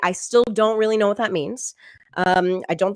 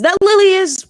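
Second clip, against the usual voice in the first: high, loud pointed mother voice; imitating mom; 12 semitones higher, 7 dB louder than usual. Mother insisting to her child, high and pointed. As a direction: mom hinting hard without saying it. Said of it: "She says".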